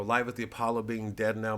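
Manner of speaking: ordinary talk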